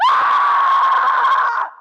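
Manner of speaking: long high-pitched loud scream; reenacted scream. Warrior recalls screaming at her friend's bullies, high sustained scream. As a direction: let out one sustained, piercing re-enacted yell. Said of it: "I just started screaming".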